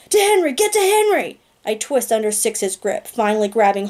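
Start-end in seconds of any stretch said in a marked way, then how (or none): 0.1-1.4 high, loud urgent shouts; screaming. Acted. Narrator screaming at Six to save Henry, high and loud. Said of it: "I scream".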